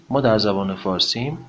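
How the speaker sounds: normal talking voice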